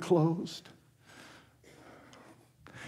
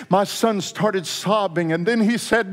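ordinary talk, nothing marked

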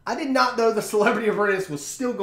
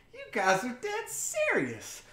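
high, slow and soft, head cocked in disbelief; slow, quiet, high-pitched "you're kidding me" to his angry crew; stunned disbelief; 6 dB quieter, slower than usual. Retold or imitated. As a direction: let it dawn on you that they mean it. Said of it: "like, crank head".

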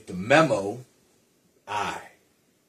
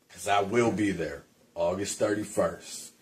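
normal talking voice

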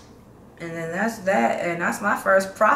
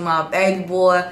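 normal talking voice

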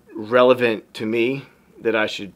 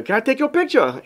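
high-pitched attempt at his cute accent; sweet, high mock accent; Brother John's accent; 13 semitones higher, faster than usual. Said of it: "I can't do the accent".

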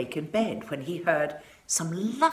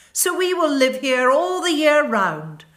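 much higher, loud gleeful cry; gleeful snow and frost; 10 semitones higher, 8 dB louder than usual. A narrator's reading. Snow and frost gleefully claiming the garden, high and loud. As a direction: frost crowing over the cold garden. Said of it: "they cried".

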